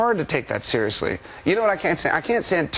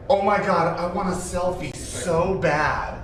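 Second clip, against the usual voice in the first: gushing fan imitation; 6 semitones higher, slower than usual. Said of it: "he goes".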